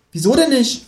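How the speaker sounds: very high, expectant creature voice; Känguru. A narrator's reading. Kangaroo waits for companion to sing, high expectant voice. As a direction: prompt expectantly, pitched right up. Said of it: "fragt das Känguru".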